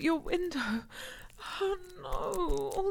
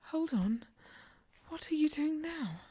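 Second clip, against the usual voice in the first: normal talking voice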